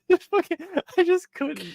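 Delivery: high, laughing, struggling for words; helpless laughter. Real, not acted. Co-host recalling the suplex meme, real helpless laughter. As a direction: crack up too much to finish.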